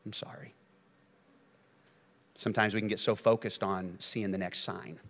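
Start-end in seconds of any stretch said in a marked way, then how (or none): 0.1-0.5 breathy whispered sorry; whispered apology. Retold or imitated. Dad to his children, whispered apology. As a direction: let it fade to a whisper. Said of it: "tears in his eyes".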